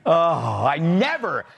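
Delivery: slow, savoring mock delight; mock rapture. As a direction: feign delight, drawing the words out. Mock delight after the played clip.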